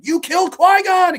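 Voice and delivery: high, loud battle cry; accusing shout. Acted. Acting out Anakin after killing of Qui-Gon, high accusing shout. Acts out imagined vengeful charge.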